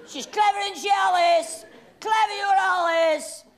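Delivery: high, loud mock flattery; loud teasing praise. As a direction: show her off to the crowd. Loud praise aimed at Alice.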